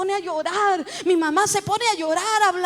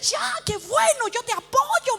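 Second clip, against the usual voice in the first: high, delighted agreement; enthusiastic; 8 semitones higher, slower than usual. Retold or imitated. Husband backing her calling, enthusiastic. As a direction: cheer on eagerly, pitched up. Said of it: "él entusiasmado porque".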